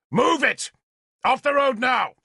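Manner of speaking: high, loud barked order; shouting at drivers. A narrator's reading. Lord shouts at the ox drivers, full shout. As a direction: drive the men like cattle. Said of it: "I shouted at the ox drivers".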